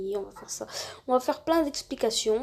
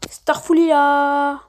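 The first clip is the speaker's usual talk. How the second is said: slow, loud, altered voice-changer voice; drawn-out loud app-changed voice; 3 semitones higher, 12 dB louder, much slower than usual. Shown as a demonstration. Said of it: "une petite démonstration de changeur de voix".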